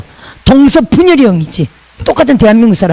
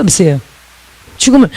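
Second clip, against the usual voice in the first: usual speaking voice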